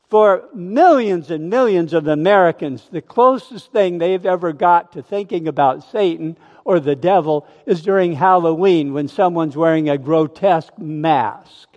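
ordinary talk

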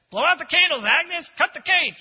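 high, loud coaxing call; loud cheerful urging. Retold or imitated. Harry loudly urges Agnes to blow out the candles, cheerful. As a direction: cheer her on to cut it.

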